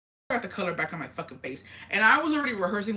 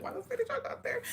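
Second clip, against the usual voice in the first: scolding aunt; 6 dB quieter, faster than usual. Retold or imitated.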